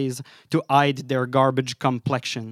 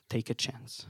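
much softer, repeated like a dying echo; fading echo; 3 semitones lower, 15 dB quieter than usual. Acted. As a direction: let the phrase fall away, barely there. Echoing refrain, fading away.

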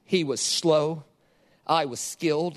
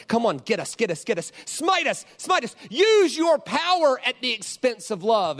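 taunting tormentors; 6 semitones higher than usual. Retold or imitated. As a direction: imitate a mob daring him to strike back. Voices tormentors taunting Jesus on cross.

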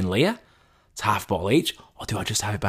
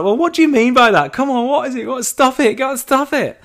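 high, loud, giggly begging to know more; laughing excited pleading; 7 dB louder than usual. Retold or imitated. Pestering his mate about the wine, laughing and excited. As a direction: tease it out of him, half laughing. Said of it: "And I was laughing my head off".

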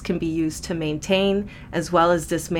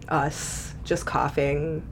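trailing whisper; 4 semitones lower, 9 dB quieter, slower than usual. Retold or imitated. Describing coughing coworkers.

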